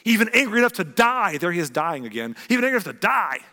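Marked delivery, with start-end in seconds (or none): 0.0-1.4 high, loud, defiant outburst; angry retort. A narrator's reading. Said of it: "he retorted". 2.5-3.4 high, loud indignant outburst; dramatic, angry insistence; upset Jonah. Acted. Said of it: "He's upset".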